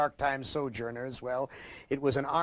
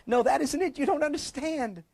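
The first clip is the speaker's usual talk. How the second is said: high, tearful insistence; crying protest; 11 semitones higher than usual. Acted. The crying man insists to his wife, high and tearful. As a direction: push the words out between sobs. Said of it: "stop crying long enough to say".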